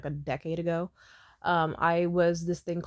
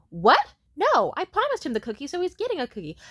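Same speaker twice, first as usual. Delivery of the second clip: loud, high indignant retort; hella loud; 8 semitones higher, 5 dB louder than usual. Retold or imitated. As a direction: imitate her objecting far too loudly. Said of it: "she says hella loud".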